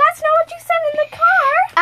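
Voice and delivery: high, loud accusing outburst; indignant shout. Real, not acted. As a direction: call her out loudly. Calling out friend's contradiction.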